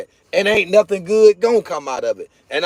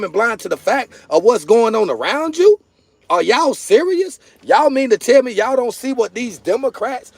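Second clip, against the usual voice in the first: high-pitched, disbelieving rhetorical questions; incredulous scolding; 5 semitones higher than usual. Real, not acted. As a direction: demand answers from viewers, voice pitched up.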